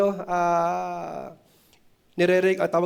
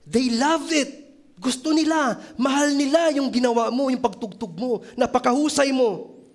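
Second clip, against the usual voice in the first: excited praise; 7 semitones higher, 3 dB louder than usual. Retold or imitated. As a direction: gush over his playing. Crowd praising the violinist. (masiglang papuri ng mga tao, mas mataas at malakas)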